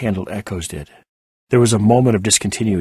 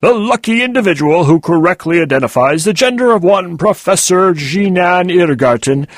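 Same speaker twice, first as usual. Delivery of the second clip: loud, higher hammed-up announcer voice; mock sports announcer; 10 semitones higher, 8 dB louder than usual. Muncie mocking the professor's gender bet, hammed-up announcer. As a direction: boom it like a mock game-show announcer. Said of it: "a mockery of a sports announcer's voice".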